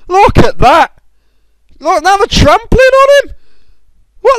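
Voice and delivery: high, loud squeals of disbelief at the screen; squealing gamer watching carnage; excited shriek. Real, not acted. Shrieking at battle replay.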